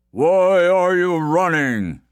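slow giant question; forest giant. Acted. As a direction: rumble it lazily.

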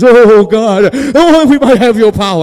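loud, high, hammed-up prayer; trying to sound religious. Praying for power, mock-religious and loud. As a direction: overdo a pleading holy cry. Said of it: "I tried to sound religious".

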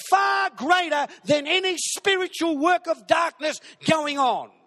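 high, loud shouting from across the room; yelled out. Retold or imitated. The crew in the corner yelling at Jess, a loud high shout. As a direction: yell it at full force across the party. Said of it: "the crew in the corner yelled out and said".

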